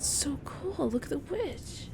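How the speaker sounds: high, hushed admiring whisper; awed whisper. Real, not acted. Gamer whispers in awe at the pretty witch. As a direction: marvel at her softly.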